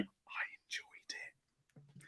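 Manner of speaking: slow, barely audible whisper; sheepish whisper. Retold or imitated. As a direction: whisper it like a guilty confession. Lone dissenter sheepishly quoting self.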